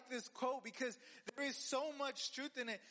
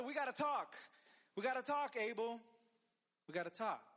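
raised, insistent demand to talk; confrontational Cain; 2 semitones higher than usual. Retold or imitated. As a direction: act out a brother demanding a talk. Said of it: "so Cain tells his brother".

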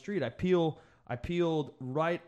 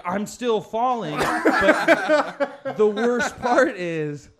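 laughing; 7 semitones higher, 8 dB louder, much slower than usual. Retold or imitated. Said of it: "you're like".

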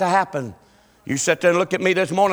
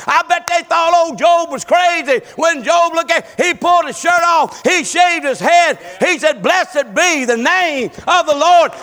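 higher, louder preaching with shouted peaks; preaching at full force; 9 semitones higher, 5 dB louder than usual. Acted. Preacher rousing the congregation with Job's story, full-force preaching. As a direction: build the Job story into a fiery sermon climax.

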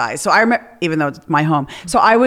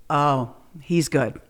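grudging hushed aside; 5 semitones lower, 7 dB quieter, much slower than usual. Retold or imitated. Grudging admiration watching episode.

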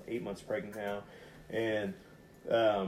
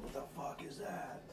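breathy scared whisper, barely voiced; single mom startled awake by knocking, whispering in fear; frightened whisper; 7 semitones higher, 10 dB quieter than usual. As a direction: whisper it like you heard something in the walls. Hearing knocking in the night.

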